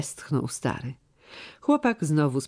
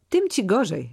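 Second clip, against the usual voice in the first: sharp and stern, pitched higher, "Tym" hit hard; stern call; 6 semitones higher than usual. A narrator's reading. Steward sternly rebuking the lad over the girl. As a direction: scold him sternly. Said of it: "zawołał surowo".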